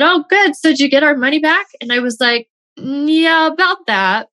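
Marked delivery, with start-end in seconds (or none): 0.0-1.7 high, quick, cheery relief; hopeful husband. Retold or imitated. Husband at dinner asking about the refund, bright and hopeful. 2.8-4.3 sheepish hedge. Retold or imitated. Admitting to husband, awkward.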